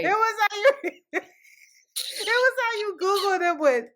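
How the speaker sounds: can barely get it out for laughing; real laughter. Real, not acted. Teasing her friend over the Google check, laughing.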